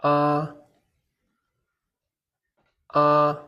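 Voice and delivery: "Ah" sustained high and flat; high level tone. Shown as a demonstration. Teacher models the first tone for learners, "Ah" high and level. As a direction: hold each "Ah" perfectly level. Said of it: "The first tone is high and flat".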